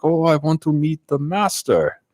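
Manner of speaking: high, imitated Russian accent; thick Russian accent. Stranger asking the smoking brothers about the lodge, in an imitated high Russian accent. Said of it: "he's got a very thick Russian accent".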